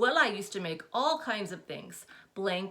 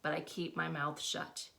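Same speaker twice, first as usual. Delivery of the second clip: ordinary delivery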